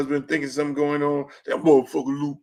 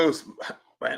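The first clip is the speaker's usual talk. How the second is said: ordinary talk, nothing marked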